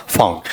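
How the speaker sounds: "फाउन" with an au glide, pitched low; taught pronunciation. Shown as a demonstration. Teacher answering own question, diphthong pronunciation. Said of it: "फोन की बजाय क्या रहेगा".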